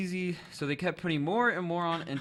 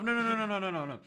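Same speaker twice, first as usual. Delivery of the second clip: normal talking voice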